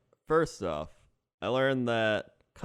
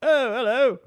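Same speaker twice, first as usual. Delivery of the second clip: put-on high, loud, jolly British accent; a John Oliver-ish British greeting; chirpy old British customs man; British officer voice; 9 semitones higher, 7 dB louder than usual. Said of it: "a John Oliver type guy".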